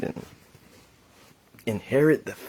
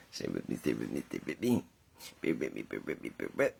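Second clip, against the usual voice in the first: choppy bad connection voice; 11 semitones lower, 11 dB quieter, much faster than usual.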